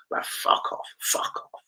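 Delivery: unvoiced whispered fuck off; whispering fuck off about Ivan Toney; whispered dismissal. Real, not acted. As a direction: whisper it as a scornful brush-off.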